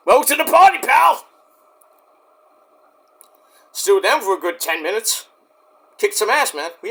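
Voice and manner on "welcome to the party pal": high, loud quoted welcome; mock hearty shout. Greeting a newcomer joining the group, mock hearty shout. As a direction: holler it out like a movie catchphrase.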